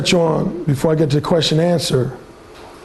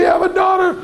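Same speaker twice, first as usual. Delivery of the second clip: mock father shout; 13 semitones higher than usual. Father lecturing daughter scene.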